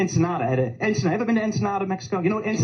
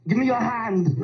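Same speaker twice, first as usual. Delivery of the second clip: put-on Austrian-accented action hero, higher and strained; Arnold Schwarzenegger impression; 5 semitones higher, slower than usual. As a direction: Schwarzenegger reaching for a falling man, Austrian accent. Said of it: "Arnold Schwarzenegger in every movie".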